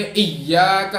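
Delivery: hard doubled y in ایاک; emphatic ایاک with tashdeed; with firmness. Shown as a demonstration. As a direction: press and hold "iyy" in ایاک. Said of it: "سختی کے ساتھ ایاک پڑھنا".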